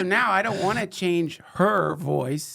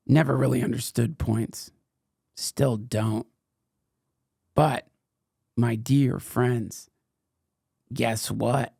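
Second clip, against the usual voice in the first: ad read; 3 semitones lower than usual. Acted. Reads a sponsor ad.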